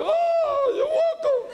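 very high hammed-up blubbering; mock crying reply. Veteran crying with the man he rescued, high mock wail. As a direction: imitate yourself bawling through the words. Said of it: "Y yo decía".

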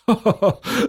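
a dad laughing at his daughter; light storybook chuckle; fatherly chuckle. Acted. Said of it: "Papa Wassermann lacht".

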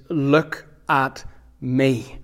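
very slow, spaced-out words; each word set apart, slowly; weighty command. Real, not acted. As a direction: deliver it as a solemn, drawn-out command. Closing command, drawn out for weight.

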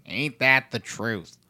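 slow drawl. Retold or imitated. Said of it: "tweet saying".

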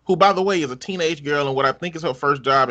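ordinary talk

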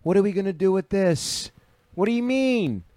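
outraged Italian lady. Retold or imitated. Wife scolding husband about the Vespa, high indignant. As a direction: play the furious wife in the clip. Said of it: "that lady going".

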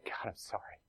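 barely breathed confession; whispered prayer. Shown as a demonstration. Pastor modelling a sinner's prayer for the congregation, whispered.

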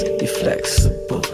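ordinary delivery

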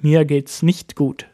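usual speaking voice

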